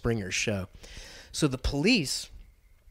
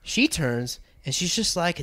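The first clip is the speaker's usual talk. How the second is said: usual speaking voice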